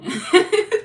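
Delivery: loud, high real laugh; burst of laughter. Real, not acted. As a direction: crack up at your own rant. Cracks up at own outburst.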